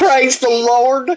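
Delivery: hammed-up revival cry; mock church praise.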